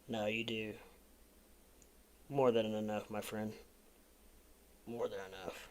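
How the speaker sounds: soft, low, heartfelt thanks; sincere reassurance. Real, not acted. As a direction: quietly reassure a generous friend. Host reassures a donor friend.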